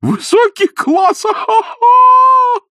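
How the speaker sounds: very high, slow laughing shout; laughing delighted. Acted. Изнуренков delighted by sparrows, high laughing cry. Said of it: "заливаясь смехом".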